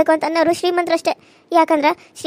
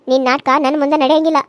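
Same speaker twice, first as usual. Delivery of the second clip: normal talking voice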